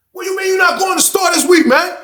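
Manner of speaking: high, loud yelling at his cellmate; imitated loud yelling. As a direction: bark the demands like a short angry inmate. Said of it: "he had a loud voice".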